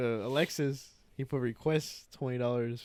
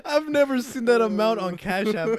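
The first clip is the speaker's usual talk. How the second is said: amazed; 8 semitones higher, 8 dB louder than usual. Real, not acted. Telling friends about the ten grand request, amazed. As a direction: stretch it out in disbelief.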